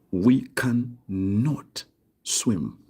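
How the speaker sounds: drawn-out words with a held not; slow dictation. Shown as a demonstration. Teacher asking the class to translate, slow dictation. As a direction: spell the sentence out word by word.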